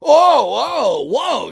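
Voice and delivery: high, loud mock gasp of "whoa"; a judge suddenly impressed, hammed up and high; mock surprise. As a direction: play a dim judge jolted awake. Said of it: "judges suddenly impressed".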